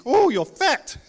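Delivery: high, loud auntie voice blurting it out; Samoan auntie. Retold or imitated. Auntie greeting a nephew she hasn't seen in ages, blunt and high. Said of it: "everyone has an auntie if you're Samoan".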